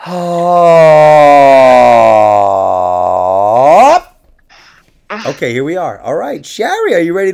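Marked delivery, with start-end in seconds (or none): 0.1-4.1 very loud, drawn-out oh; loud moan like a mermaid yawning; weird long moan. Real, not acted. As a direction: let out a long strange groan. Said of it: "What kind of fucking shit was that?".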